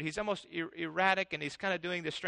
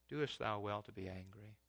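soft, much quieter, tender question; gentle God; 8 semitones lower, 12 dB quieter than usual. Acted. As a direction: ask it softly, like a patient father. God's gentle question to Jonah.

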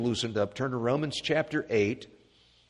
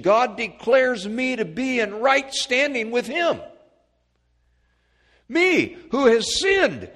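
high, loud preacher's cadence; full-force preaching; 9 semitones higher, 9 dB louder than usual. Acted. Preacher proclaiming redemption to the congregation, full-force preaching. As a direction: proclaim it to the whole church.

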